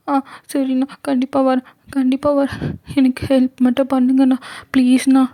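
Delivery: put-on high, tearful girl's desperate pleading; pleading young girl. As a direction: plead for her sick mother in a high, teary voice. (அம்மாவுக்காக கெஞ்சும் சிறுமி குரல்)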